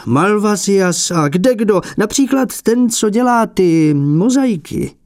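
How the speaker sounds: put-on a prickly old painter feigning modesty; fussy old abbot. A narrator's reading.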